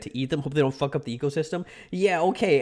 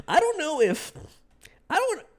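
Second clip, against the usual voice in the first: jumps far higher, squeaking out; squeaky high voice; 13 semitones higher than usual. Real, not acted. Nervous about saying too much, squeaking high. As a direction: voice cracking up high in panic.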